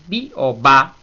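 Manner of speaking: "bi o ba" loud, with the pitch lifted; rising tone. Shown as a demonstration. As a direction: push the pitch up through "bi o ba". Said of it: "y ahí sube".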